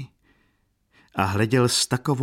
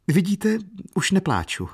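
tearful insistence; 12 semitones higher than usual. A narrator's reading. Katerina swallowing tears before Erzilia, high-pitched insistence. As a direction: insist brightly that all is fine.

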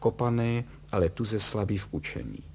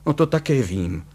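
loud, irritated retort; exasperated husband's shout; 4 semitones higher, 7 dB louder than usual. A narrator's reading. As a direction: snap back loudly, raised in pitch. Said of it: "křikl Kalous".

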